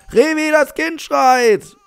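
very high, drawn-out yell; urgent calling out. Pretend. Player calls game father to crying baby, urgent yell. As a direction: summon someone in mock alarm.

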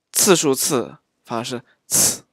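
ordinary delivery

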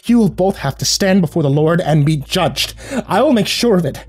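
high-pitched fire-and-brimstone threat; righteous fury. A narrator's reading. Raging aunt threatens divine judgment.

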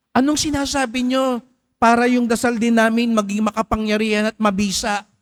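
high, pleading reporter asking a favour; a reporter begging Mother Teresa for her secret; eager reporter. Retold or imitated. Reporter asking Mother Teresa, eager and pleading. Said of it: "sabi nung reporter".

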